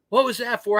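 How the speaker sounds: a hint of an indignant old man; protesting old man. Veteran protests to his wife a second time, high mock voice.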